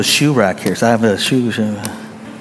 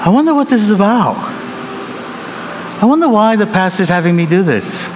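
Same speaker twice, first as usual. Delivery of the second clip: a lightly voiced, quick, wondering member; puzzled church member; 5 semitones higher, faster than usual. Church member puzzled by the pastor's task, quick and wondering. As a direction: rattle it off half to yourself.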